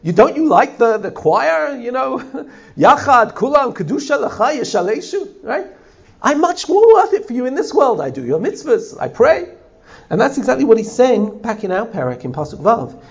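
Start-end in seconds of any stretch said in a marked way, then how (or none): 0.0-9.5 high, quick, pleading bargain with God; arguing with God. Retold or imitated. A person bargaining with God to stay alive, high and eager. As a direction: coax God with eager, pitched-up reasoning. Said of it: "we argue with God and say".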